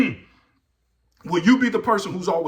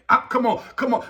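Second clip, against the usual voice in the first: normal talking voice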